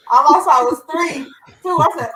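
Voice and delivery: laughing loud and high; blurting it out through laughter. Real, not acted. Host explaining her giggles to co-hosts, laughing. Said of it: "I'm laughing".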